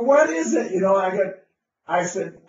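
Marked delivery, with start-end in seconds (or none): 0.0-0.7 high, eager ask; lightly voiced eager crowd; eager question. People asking eagerly.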